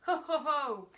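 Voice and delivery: booming mock laugh, high and loud; villain's laugh. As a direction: laugh it out like a gloating brute. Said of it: "cried the enormous crocodile".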